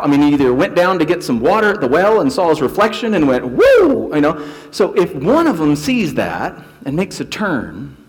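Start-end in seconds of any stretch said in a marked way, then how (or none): 3.5-4.1 joyful whoop. Acted. Leper seeing his healed reflection.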